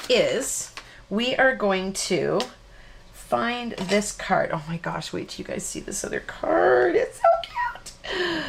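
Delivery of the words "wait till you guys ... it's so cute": excited. Real, not acted. Excited about another card.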